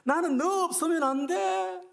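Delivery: a lover who cannot live without you; desperate cry. Acted. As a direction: cry out 나는 너 없으면 안 돼 with 너 soaring high. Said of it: "하나님의 마음이".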